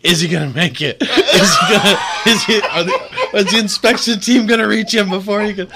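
high, giggly, laughing through the question; laughter bubbling through the words; laughing quote. Retold or imitated. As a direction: chuckle out each question, barely holding it together. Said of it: "I'm just chuckling it up".

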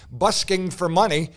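ordinary delivery

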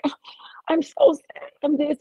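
high-pitched whiny damsel-in-distress imitation; mock crying. Mocking a crier who plays damsel in distress, mock weepy. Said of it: "she's a crier".

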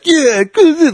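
high, hammed-up movie jock; jock cheering. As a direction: mimic a jock's celebratory cheer. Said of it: "like, the jocks of".

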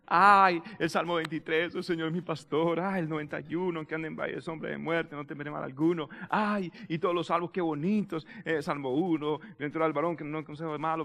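low, soft, dreamy recital; mock devout fondness. Preacher imitating lovers of pretty Psalms, mock devout fondness. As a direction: coo over favourite verses, gently sending it up.

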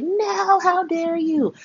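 high mock indignation; outraged Harmony. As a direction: shriek it high like a diva. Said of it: "She's just like".

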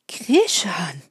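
ordinary delivery, nothing marked

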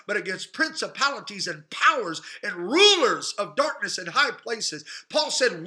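loud, rising preacher cadence; preaching. Acted. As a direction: preach it out to the congregation. Preaching at full force.

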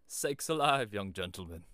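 put-on startled old shepherd, loud and high; loud country exclamation of shock. A narrator's reading. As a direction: burst out in rustic alarm. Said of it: "in a startled voice".

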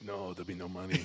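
Scottish organizer telling throwers there is no pay; Scottish accent. Retold or imitated. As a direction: flatly refuse in a Scottish brogue. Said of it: "you do the accent".

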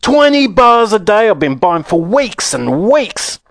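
high, slow, indignant yell; outraged boy's complaint; angry shout. A narrator's reading. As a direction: hammer out the grievance loudly. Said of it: "a large boy shouted angrily".

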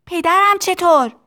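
put-on high child's yell; shouted question. A narrator's reading. Tistu asking about his father's side, high shout. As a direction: call the question out over noise. Shouted exchange over factory noise.

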